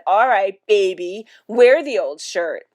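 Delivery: loud, grudging retort; exasperated. Acted. Father gives up the shirt to crying mother, exasperated. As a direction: give in loudly, slowing at the end.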